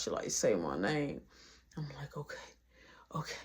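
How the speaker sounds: very soft, low, half-whispered; hushed retelling. Retold or imitated. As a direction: tell the intimate part almost under your breath. Retold intimate scene, lowered voice.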